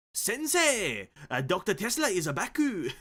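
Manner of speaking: loud, high put-on Japanese accent; hammed-up Japanese accent, shouted high. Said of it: "A Japanese accent?".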